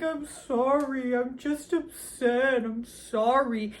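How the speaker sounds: slow, whiny mock apology; imitating the girl apologizing to Roy, drawn out. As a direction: drag out the sorry like a sulky teen. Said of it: "She's like".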